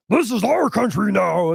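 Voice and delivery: slow, mocking imitation of a put-on villain voice; imitating the odd voice. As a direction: drag it out like a cartoon grouch. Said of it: "that's what he's talking like".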